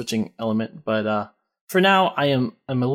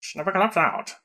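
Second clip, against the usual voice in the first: 1950s narrator; 5 semitones higher, 4 dB quieter than usual. Pretend. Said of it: "old-timey racetrack announcer guy".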